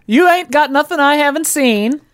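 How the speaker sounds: high, sing-song insisting; pushy Buzz voice. Acted. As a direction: coax your way in, lightly pushy. Buzz pushes in.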